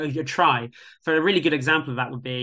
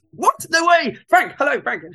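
much higher, louder mock fan shriek; starstruck squeal; 12 semitones higher, 6 dB louder than usual. Colleague starstruck by [name].

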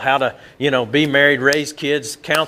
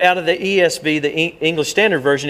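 ordinary talk

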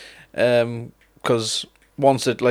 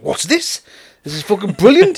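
higher, louder thrilled exclaim; excited discovery; 7 semitones higher, 4 dB louder than usual. Retold or imitated. Gamer hearing the opening riff, thrilled high exclaim. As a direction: relive the moment the riff hit. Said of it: "I was like".

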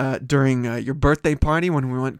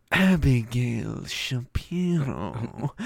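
Abigail Shapiro stretched very slowly, lower; drawn-out teasing name; 4 semitones lower, 6 dB quieter, much slower than usual. Pretend. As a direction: drag out every syllable of the name.

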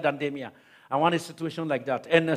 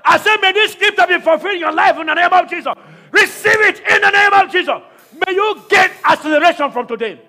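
higher and louder, slow, fervent shouted prayer; preaching at full force; 10 semitones higher, 11 dB louder than usual. Acted. Pastor to congregation, praying over them, shouted preaching at full force.

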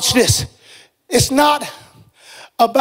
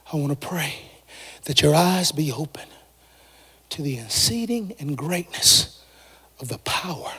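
quiet and much lower, fading into a whisper; hushed prayer; 9 semitones lower, 10 dB quieter than usual. Acted. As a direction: pray it almost under your breath.

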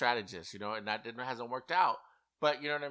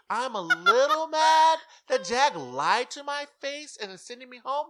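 mock Blue speech; 12 semitones higher, 8 dB louder than usual. Mocking Blue's goodbye speech to the house with a high, giggly put-on voice. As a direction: imitate a ditzy goodbye speech, laughing. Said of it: "how she got up there and said".

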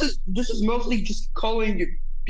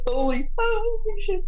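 surprised; 13 semitones higher than usual. Real, not acted. Startled reaction to the picture.

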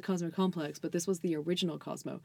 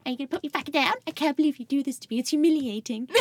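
high, louder whiny protest; mock indignant; 8 semitones higher, 6 dB louder than usual. Carried over a friend's shoulder down the street, mock indignant. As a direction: imitate yourself in squeaky outrage.

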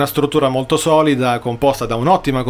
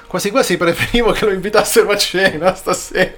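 stifled giggles; 4 semitones higher than usual. Real, not acted. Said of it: "[name], smettila di ridere".

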